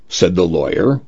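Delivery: usual speaking voice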